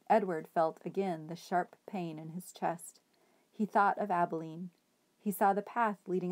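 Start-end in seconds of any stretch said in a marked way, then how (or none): none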